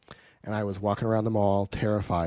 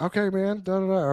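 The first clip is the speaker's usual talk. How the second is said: much higher, timid mock voice; meek placating reply; 9 semitones higher than usual. Young salesman placating an angry business owner, high timid mock voice. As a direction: squeak it out like you're backing down. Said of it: "And I'm like".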